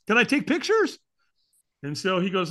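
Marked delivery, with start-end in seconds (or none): 0.1-1.0 loud, high-pitched thrill; hammed-up spectator excitement; gleeful eagerness. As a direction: egg everyone on in a high, excited voice. Acts out mischievous eagerness.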